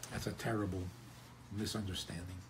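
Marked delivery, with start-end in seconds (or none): none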